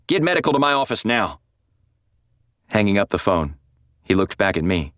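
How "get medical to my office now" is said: yelled order. A narrator's reading. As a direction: snap the order into the phone, pitched high. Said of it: "yelled into the receiver".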